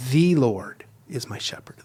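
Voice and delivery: "The" drawn out as THEE, slow; stress on The. Shown as a demonstration. Teacher demonstrating emphases in prayer, stress on "The". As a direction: lean long and slow on "The", as in the one and only. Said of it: "you read it over with different emphases".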